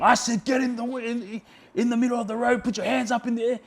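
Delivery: imitating a cop's high-pitched yelled order; mock police yell, pitched well up; retold yelling. Cop ordering the driver into the road, imitated yelling. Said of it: "he just kept yelling at me".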